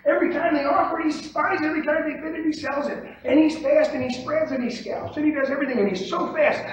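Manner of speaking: high-pitched, worked-up complaint, piling up one point after another; retold exasperated rant. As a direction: retell a fretful rant, pitched well up. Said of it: "I always went home at night and I'd say".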